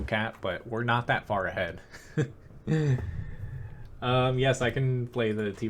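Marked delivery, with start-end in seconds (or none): 1.8-3.9 short airy laugh trailing down; breathy laugh. Real, not acted. Player laughing about his team capping, airy laugh. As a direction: chuckle off the call.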